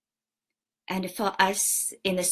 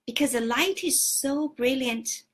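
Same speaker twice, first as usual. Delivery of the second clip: normal talking voice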